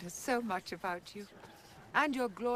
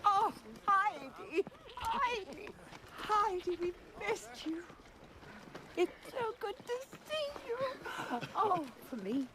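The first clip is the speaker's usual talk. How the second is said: high, delighted greeting; reunion joy; 9 semitones higher than usual. Acted. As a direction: gush with joy at seeing her. Grandmother greeting Heidi after absence.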